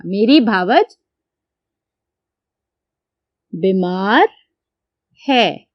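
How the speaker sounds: dictating मेरी भावज; dictation pace. Shown as a demonstration. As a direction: spell it out slowly for the class to write. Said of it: "मैं लिखती हूँ".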